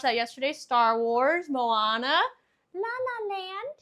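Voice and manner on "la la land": higher, slow, oddly put-on; a strange squeaky slow read; a high, slow, silly voice. Real, not acted. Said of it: "Why'd I say it like that?".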